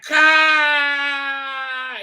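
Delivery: high, loud strangled cry; hammed-up dying shout; mock death cry. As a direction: cry out as you get shot. Thal shot down.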